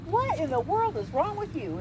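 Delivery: high, startled wife's question; alarmed wife. Acted. Wife confronts her panting husband, high and alarmed. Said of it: "his wife looks at him and says".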